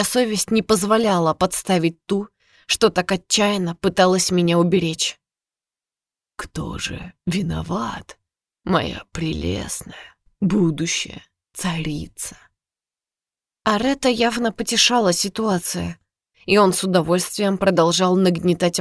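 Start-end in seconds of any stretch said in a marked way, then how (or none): 6.4-12.4 slow, soft menacing whisper; mocking whisper. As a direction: whisper it with cruel amusement. Aret whispering mockingly to heroine.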